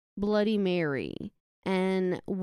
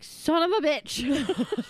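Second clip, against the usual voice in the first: slow, higher, drawn-out frustrated curse; raging gamer; 6 semitones higher, much slower than usual. Retold or imitated. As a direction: groan it out like a sore loser. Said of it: "I was like".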